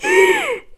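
very high piercing shriek; sudden shrill scream. A narrator's reading. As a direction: shriek like a frightened girl. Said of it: "he heard Annie shriek".

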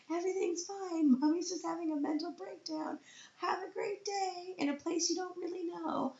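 very high, squeaky fake cheer; hammed-up all-is-well voice on the edge of collapse; mock breakdown cheerfulness. Mother waving off her son, falsely cheerful through tears. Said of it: "I can't cry in front of him".

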